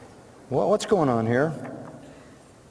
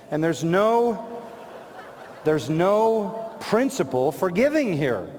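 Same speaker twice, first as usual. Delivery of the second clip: slow, deliberate statement; slow emphasis; 3 semitones higher, slower than usual. Real, not acted. Preacher stating his conclusion slowly and deliberately. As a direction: lay each word down slowly.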